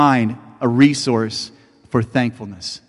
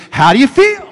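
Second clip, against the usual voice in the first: mock reporter; 11 semitones higher, 5 dB louder than usual. Reporter with microphone.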